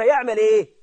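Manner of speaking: usual speaking voice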